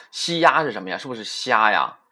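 normal talking voice

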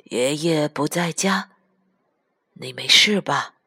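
put-on low old man's voice; gentle grandfather checking on a child; concerned grandpa. A narrator's reading. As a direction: ask it low and tenderly. Grandpa asks child before hospital stay.

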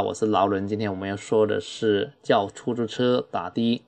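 ordinary delivery

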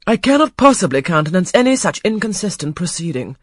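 stern dowager. A narrator's reading. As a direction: forbid it grandly. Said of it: "chimed in the Dowager Ingram".

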